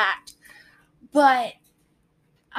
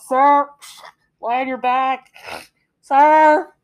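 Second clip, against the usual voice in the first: loud call over a loudspeaker, pitched up; lifeguard on the PA; 2 semitones higher, 11 dB louder, slower than usual. Retold or imitated. Lifeguards on duty call to the flailing swimmer, loud PA voice. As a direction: boom it out like a pool announcement. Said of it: "'cause they're on a P A system".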